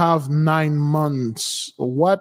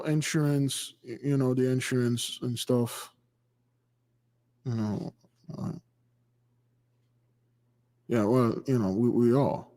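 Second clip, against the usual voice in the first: mumbled much softer and lower, trailing off; hesitant mumble; 5 semitones lower, 7 dB quieter than usual. Retold or imitated. Imitating a flustered resident asked about rebuilding, mumbling softly. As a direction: stall as if dodging the question.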